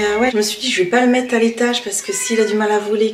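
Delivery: normal talking voice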